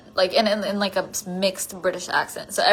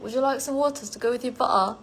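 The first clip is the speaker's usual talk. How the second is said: quick, higher mock British accent; 4 semitones higher, faster than usual. Streamer imitating the joke with British friends, quick British accent. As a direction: rattle it off in put-on British. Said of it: "With my British friends. It was like".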